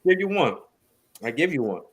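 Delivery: ordinary delivery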